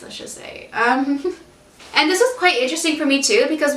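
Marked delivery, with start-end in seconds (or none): 0.0-0.7 whispered aside. Real, not acted. Trailing wry aside.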